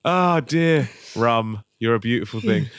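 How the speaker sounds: ordinary talk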